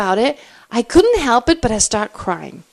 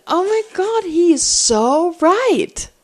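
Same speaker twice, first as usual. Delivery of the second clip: stunned realisation; 6 semitones higher, slower than usual. Retold or imitated. Retold inner realisation.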